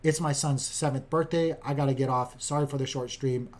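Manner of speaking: ordinary delivery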